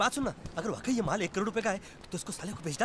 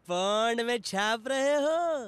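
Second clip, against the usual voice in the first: teasing; much slower than usual. Acted. Teasing question about earnings.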